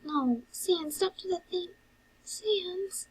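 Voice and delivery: high, soft-edged protest; pleading brother. Acted. Brother stopping Sans from leaving, pleading. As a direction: plead in a high, worried character voice.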